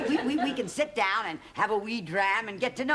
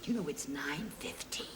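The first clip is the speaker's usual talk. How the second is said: whispered, very soft; 7 semitones lower, 10 dB quieter than usual. Real, not acted. [name] whispers to Robert beside the sleeping fan. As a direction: whisper so the sleeper stays asleep.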